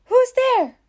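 high, loud alarmed question; jumpy storybook alarm; startled question. A narrator's reading. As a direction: call out as if startled awake. Baby startled awake by noise.

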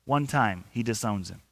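normal talking voice

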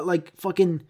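ordinary talk

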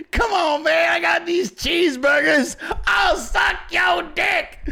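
high, drawn-out pleading caricature; mock desperate begging. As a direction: beg pathetically as a hammed-up politician. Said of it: "Dude was out here begging".

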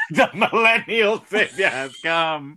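slow laughing proclamation. Acted. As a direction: laugh through a grand announcement.

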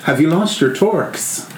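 put-on retry the line as the boy; young boy voice. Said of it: "Try that again".

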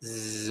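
short low zzz; mosquito buzz. Shown as a demonstration. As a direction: imitate a mosquito. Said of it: "un mosquito". (zumbido de mosquito)

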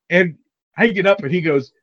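normal talking voice

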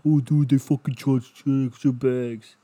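loud, slow put-on dude voice; loud mock bro voice, slow and drawn out; mocking comedian voice. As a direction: ham up a hack comic's bit. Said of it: "when I change my voice, it actually does sound different".

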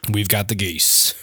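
low, slow gravelly Southern voice; mock gravelly Southern advert; gravelly Southern huckster. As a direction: draw it out like a Southern salesman. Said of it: "gravelly Southern accent man".

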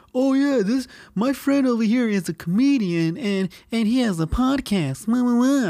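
mock a drunk pal bragging you up; drunk friend. Mimicking drunk friend introducing him.

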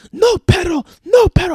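high, loud Spanish scolding; imitated shouting. Roommate beating the dog with a broom, mock frantic shouting.

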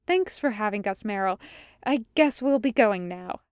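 ordinary delivery

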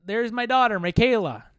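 mock showman dad; hammed-up proud father; imitating the father presenting. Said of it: "he's like".

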